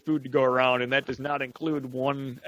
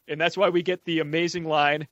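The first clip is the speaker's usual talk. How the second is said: ordinary delivery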